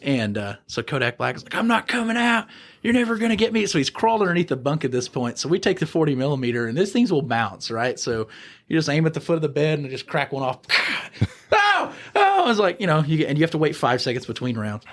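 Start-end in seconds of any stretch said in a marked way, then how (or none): 1.5-3.7 high-pitched taunting refusal; mock defiance. Inmate refusing under bunk. 11.5-12.4 two very high acted yelps; mock yelps of pain. Inmate hit, retold.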